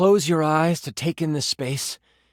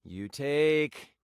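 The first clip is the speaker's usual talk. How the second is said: ordinary delivery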